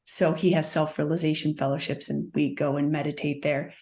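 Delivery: ordinary talk, nothing marked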